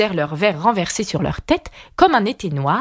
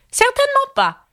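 high, curt queen's refusal; peremptory; 3 dB louder than usual. A narrator's reading. Red Queen refusing Alice at table, peremptory. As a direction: shut it down like a haughty queen. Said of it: "d'un ton péremptoire".

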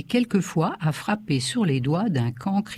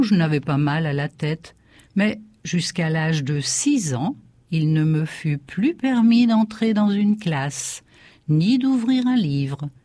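ordinary delivery, nothing marked

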